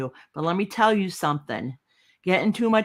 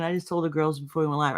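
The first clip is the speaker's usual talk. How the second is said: usual speaking voice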